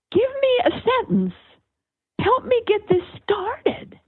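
high, playful imitation of yelling; mock yell. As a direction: call out a mock plea in a high voice. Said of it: "I would yell out".

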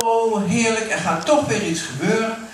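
the angel cheering up; hopeful delight. Acted. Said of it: "ze begon te denken". (a touch of vrolijke hoop)

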